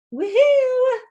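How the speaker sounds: high, loud woohoo; cheer. Real, not acted. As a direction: cheer the finish. Celebrating end of story.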